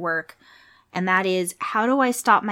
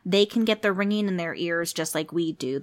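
normal talking voice

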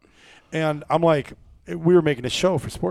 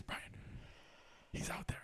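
hushed whisper; 25 dB quieter than usual. Retold or imitated. Whispering in blind near buck.

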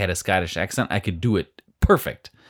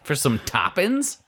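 put-on British accent; 7 semitones higher, slower than usual. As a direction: play a hammy British chimney sweep. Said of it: "so many different British accents".

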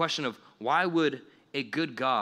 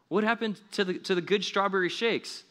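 high, indignant disbelief; dismayed customer; 6 semitones higher than usual. Pretend. Customer protests to In-N-Out about the good shakes, high and indignant. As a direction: ask it shocked, pitch way up. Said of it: "And you're like".

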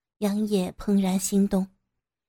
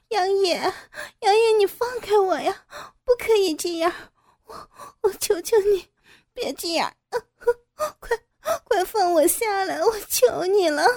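much higher, louder sobbing pleas; desperate pleading cry; 10 semitones higher, 3 dB louder than usual. Acted. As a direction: beg in terror, voice breaking into crying. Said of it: "大声的喊叫".

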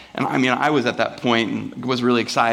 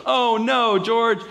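high, singsong "Oh no, George"; book catchphrase; 10 semitones higher, much slower than usual. Retold or imitated. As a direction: read it like a children's book refrain. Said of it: "and it goes".

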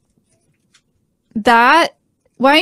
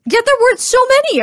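very high, incredulous insistence; insistent contradiction; 13 semitones higher than usual. Real, not acted. Friend disputing there were no glugs, high insistence. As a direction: argue back with pitch shooting way up.

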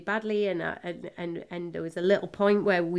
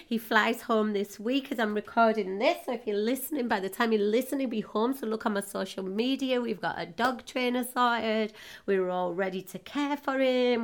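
normal talking voice

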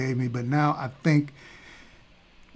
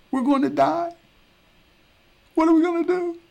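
panicked servant; 12 semitones higher than usual. Acted. Gehazi telling Elisha what he saw, very high hysterical cry. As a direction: shriek it like the end has come.

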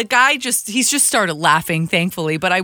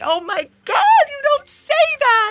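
very high panicked squeal; mortified shriek. Retold or imitated. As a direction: shriek it in horror. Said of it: "I'm like".

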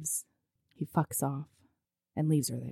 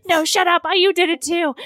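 tense rushed bicker, half hammed; mock angry bickering; 7 semitones higher, faster than usual. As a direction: couple snapping at each other. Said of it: "really angrily whispering at each other".

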